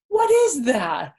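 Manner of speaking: startled loud question; sharp gasp and cry out; gasp, then shout of surprise. Real, not acted. Gasp at lobster picture.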